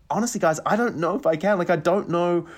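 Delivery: high-pitched helpless pleading; flustered doubt. Retold or imitated. Actor telling directors he can't do it, flustered. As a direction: plead that it is beyond you.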